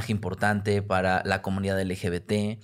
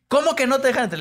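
much higher, louder, shrill indignant question; outraged disbelief; 6 dB louder, faster than usual. Retold or imitated. Producers demanding to know why he was refused, shrill outrage. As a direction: producers storming out to defend him. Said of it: "salieron los productores de lol por mí".

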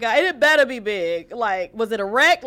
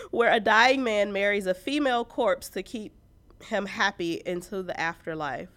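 ordinary talk

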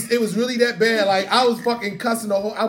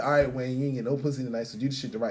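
low self-talk; 10 semitones lower, 10 dB quieter than usual. Retold or imitated. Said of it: "I was like".

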